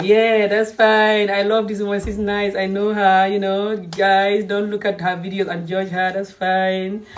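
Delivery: drawn-out, even-toned mimicry of approval; mock approving man. Woman mimics Silla excusing the woman he watches, mock approving. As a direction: stretch it out, imitating his easy indulgence. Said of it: "And then he's like".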